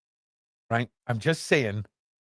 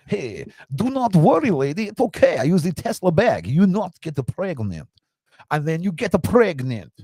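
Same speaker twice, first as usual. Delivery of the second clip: higher, swooping mock foreign accent; Elon; 5 semitones higher, 3 dB louder than usual. Tech boss fobbing off a lady about pregnancy, high cartoon accented voice. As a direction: ham up an obtuse accented caricature, pitched up. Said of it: "this is how he sounds in my brain".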